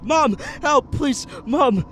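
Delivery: high, loud desperate screams; screaming for help. Acted. Boy grabbed at his door screams for his mom in terror. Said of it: "I screamed as loud as I could".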